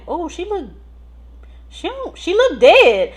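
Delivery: high, shocked "she look dead"; alarmed. Retold or imitated. Nurse reliving finding her patient, alarmed. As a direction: gasp it out in alarm. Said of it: "I'm like".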